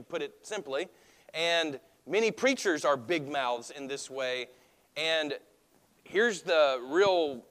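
ordinary delivery

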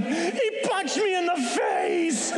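high, slow tearful wail, hammed-up; mock crying. Crying brother telling what happened in the tunnel, mock bawling. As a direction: bawl it like a hurt child, played for laughs. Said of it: "and he's crying".

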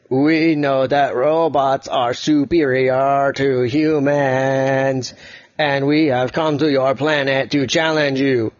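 slow, even robot voice. Acted. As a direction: drone it out like a robot. Red robot speaks.

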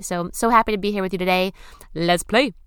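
loud, quick, hyped welcome; upbeat host. Acted. Host greeting listeners in the show intro, loud quick hyped. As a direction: sell the joy of being here.

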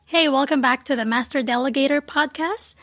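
usual speaking voice

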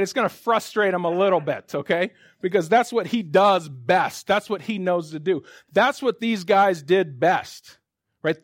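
ordinary delivery

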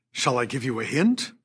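ordinary talk

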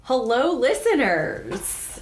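high, slow host hello; drawn-out host greeting. Pretend. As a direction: stretch out a grand welcome. Podcast intro greeting.

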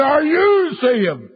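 very high holler, stretched right out. Retold or imitated. Evangelist repeating his question to the preacher, imitated drawn-out bigger shout. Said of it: "I shout it louder".

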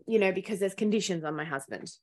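normal talking voice

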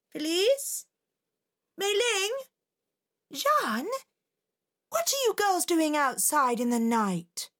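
mother searching for her daughters; mother calling. Acted. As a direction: call out for the girls in the dark.

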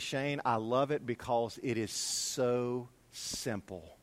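slow, low, softly retold answer; quiet sincere reply. Church member explaining his favorite verse, quiet and sincere. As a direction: say it softly, drawing out the words. Retelling friend's heartfelt answer.

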